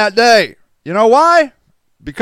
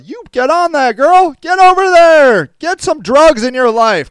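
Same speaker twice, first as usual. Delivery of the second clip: mock cheering on; 9 semitones higher, 5 dB louder than usual. Cheering a viewer on for drugs.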